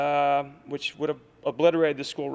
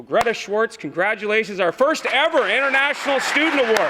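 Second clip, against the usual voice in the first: rising, louder, building to a proud cheer; excited announcement; 6 semitones higher, 5 dB louder than usual. Real, not acted. Coach announcing the award winner to the crowd, excited. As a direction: call out the winner's name like a ceremony host, climbing higher.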